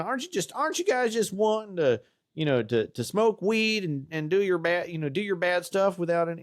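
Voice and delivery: high, slow put-on Texas twang; South Texas drawl. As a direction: drawl the critic's question like a South Texas preacher. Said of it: "my, you know, uh, my South Texas drawl there".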